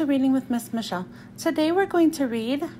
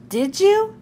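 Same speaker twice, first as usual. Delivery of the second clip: high, astonished little question; gasped question; 7 semitones higher, 5 dB louder than usual. A narrator's reading. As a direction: ask it pitched up, as if gasping. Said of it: "I gasped".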